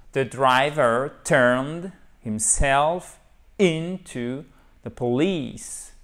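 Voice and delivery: slow, word by word English dictation; deliberate teacher read-out with pauses; very slow model sentence. Shown as a demonstration. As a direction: stretch each stressed syllable for learners. Teacher models the full answer slowly.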